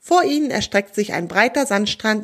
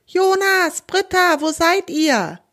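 high, loud calls across the beach; calling out loud; 9 semitones higher, slower than usual. A narrator's reading. Fathers calling for lost children, loud. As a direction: shout their names into the wind. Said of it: "laute Rufe hallen".

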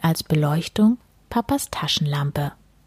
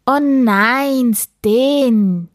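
loud, raised, drawn-out cry of dismay; high, falling shout; dismayed call; 6 semitones higher, 5 dB louder, much slower than usual. A narrator's reading. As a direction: call out in protest as an exasperated father. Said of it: "rief Papa".

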